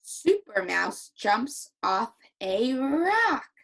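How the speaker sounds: slow, loud, happy read-aloud; big happy storybook voice; excited and happy. Shown as a demonstration. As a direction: stretch each word, swelling on the last. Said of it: "I have to use an excited and happy voice".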